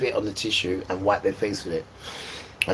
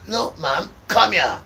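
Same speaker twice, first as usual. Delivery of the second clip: calling mum; 9 semitones higher, 5 dB louder, slower than usual. Retold or imitated. Boy calling mum in pain.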